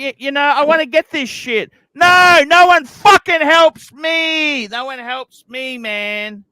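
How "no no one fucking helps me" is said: loud, slow, exasperated outburst; frustrated shout. Real, not acted. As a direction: shout it out, fed up. Venting frustration.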